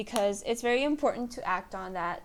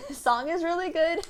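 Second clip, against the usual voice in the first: laughing through it; 8 semitones higher, 5 dB louder than usual. Shown as a demonstration. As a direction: repeat it giggling, a little higher. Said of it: "And the song is really good".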